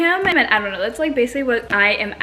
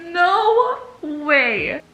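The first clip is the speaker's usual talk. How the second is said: shocked by the plot twist; stunned disbelief; 8 semitones higher than usual. Real, not acted. Reacting to book twist.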